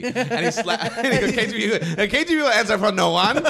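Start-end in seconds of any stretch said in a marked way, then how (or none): 1.3-3.5 high, loud punchline yelled through laughter; mock menacing shout. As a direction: bellow the threat, barely holding in laughter. Acts the joke's punchline, laughing.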